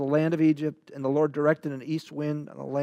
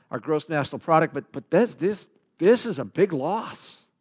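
ordinary delivery

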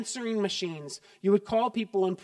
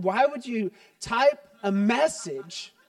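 slow, louder, higher scoffing question; mock indignation; 4 semitones higher, 5 dB louder, slower than usual. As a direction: scoff at the idea of texting. Mock outrage at texting.

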